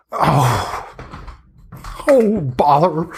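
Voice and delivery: a fussy crone annoyed at herself; hag grumbling. Acted. Hag curses after her fall.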